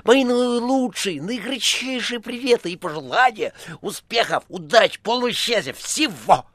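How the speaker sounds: high, gushing well-wisher; over-the-top high farewell; effusive phone caller. A narrator's reading. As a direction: pile up greetings in a high florid cry. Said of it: "говорила трубка".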